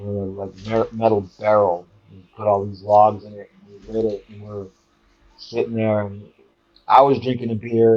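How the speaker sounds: plain reading voice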